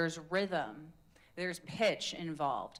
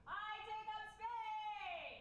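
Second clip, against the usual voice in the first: much higher, slow, soft sustained call; own pitch; 12 dB quieter, much slower than usual. Shown as a demonstration. Coach demos choosing a high pitch for the affirmation. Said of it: "choose your own pitch".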